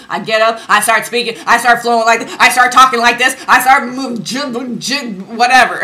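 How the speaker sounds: loud, high, building excitement; fired up. Real, not acted. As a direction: rattle off each step with rising energy. Excitedly acting out getting herself going.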